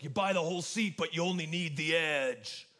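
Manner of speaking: deep bassy announcer voice imitation; monster truck ad. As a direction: do the monster truck commercial guy. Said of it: "that guy with the deep, bassy voice".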